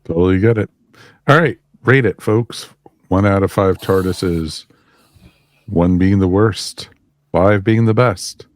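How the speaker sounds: ordinary talk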